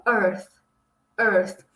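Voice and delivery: Earth with the tongue between the teeth and a small puff of air; careful Earth demo; English Earth. Shown as a demonstration. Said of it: "vai dar um pequeno soprinho".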